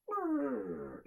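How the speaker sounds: high, falling rolled creak; imitated creak. Inn door opens at night, mouth-made creak.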